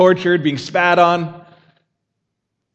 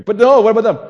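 raised, louder protest; worried protest; 8 semitones higher, 6 dB louder, faster than usual. Pretend. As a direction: blurt it out, higher and louder. Worried believer voiced.

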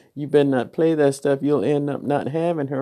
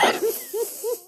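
laugh; 6 dB quieter than usual. Real, not acted. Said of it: "because I'm half sleepy".

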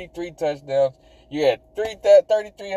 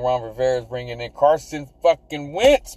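normal talking voice

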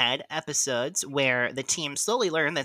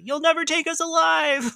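Clark Gregg; 7 dB louder than usual. Retold or imitated. Podcast host re-enacting a favourite TV moment, theatrical defiant shout. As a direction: shout it out as a dramatic last stand. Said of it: "moment by Clark Gregg".

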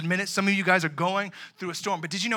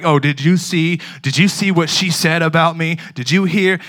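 mock gossip to friend; 10 dB louder than usual. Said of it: "you're like".

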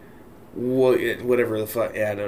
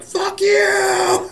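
angry shout. Retold or imitated. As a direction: scream it out in frustration. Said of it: "then that's where I'm like".

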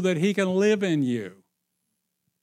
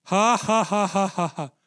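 forced laugh. Retold or imitated.

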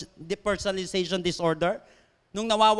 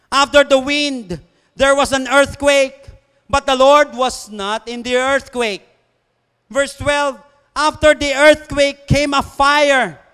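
loud, slow proclamation of scripture; preached scripture reading; 4 semitones higher, 9 dB louder, much slower than usual. Acted. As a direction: proclaim the verse to the congregation, drawn out and building louder. Reads scripture at full force.

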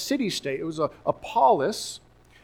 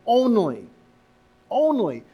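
"Only, only" lifted high and louder, each word landing hard; emphatic only; 6 semitones higher, 3 dB louder than usual. Shown as a demonstration. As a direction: lean hard on "only" both times, higher up. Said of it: "the very first thing out of their mouth".

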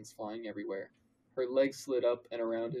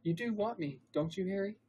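higher female character voice; Hermione; 9 semitones higher than usual. A narrator's reading. Narrator as Hermione asking Harry, higher voice. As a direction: pitch it up, coaxing him.

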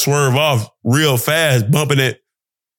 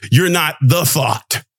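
ordinary delivery

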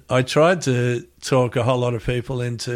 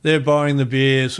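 usual speaking voice

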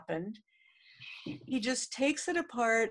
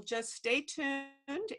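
drawn-out sign-off; 4 semitones higher, 5 dB quieter, much slower than usual. Real, not acted. Signing off to listeners.

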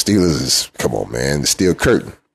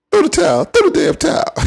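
Rocky trainer holler. Retold or imitated. Fan hollering at Cowboys to quit, much higher yell. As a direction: yell it like a desperate corner man from Rocky. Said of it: "I'm hollering like, uh, Barbarian from Rocky".

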